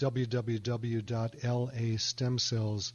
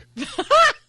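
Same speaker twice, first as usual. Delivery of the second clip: high, loud burst of laughing; real laugh, loud and high; burst of laughter; 12 dB louder than usual. Real, not acted. Joke teller cracks up at own punchline, high loud laugh.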